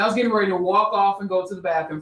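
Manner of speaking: ordinary talk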